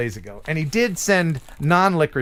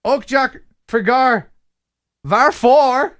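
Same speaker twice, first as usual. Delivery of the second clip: higher, louder Swedish, word by word; attempted Swedish reading; 7 semitones higher, 4 dB louder, slower than usual. Shown as a demonstration. Host reads on through the limerick, emphatic halting Swedish. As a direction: push out each Swedish word separately. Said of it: "actually read it in Swedish".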